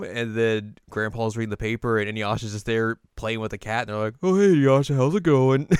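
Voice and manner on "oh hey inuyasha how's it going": family greeting. Retold or imitated. Voicing family greeting Inuyasha.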